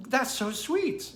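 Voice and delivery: high, drawn-out touched gushing; touched reaction. Retold or imitated. Retelling own reaction to a paid-for meal, touched. Reacting to kind gesture in story.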